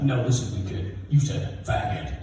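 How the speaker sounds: low, restrained retold anger; pissed principal. Principal correcting the boy, quietly pissed. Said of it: "No, he was pissed".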